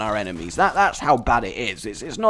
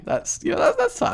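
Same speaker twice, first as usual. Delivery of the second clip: squeaky strained falsetto; mock fine. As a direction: pretend it's all fine, voice cracking high. Strained falsetto "it's fine" bit.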